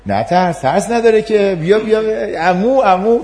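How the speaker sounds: high, eager coaxing call; coaxing parent. Acted. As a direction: urge the child toward uncle. Parent pushing child to guest.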